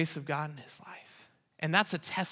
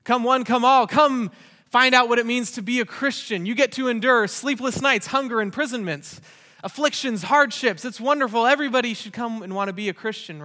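mock sales pitch; 6 semitones higher, 7 dB louder than usual.

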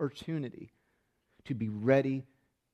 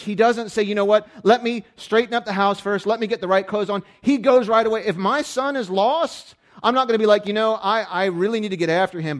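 loud, high, driving sermon delivery; full-throated pulpit preaching; preaching at full force; 6 semitones higher, 8 dB louder than usual. Acted. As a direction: preach it hard to the room.

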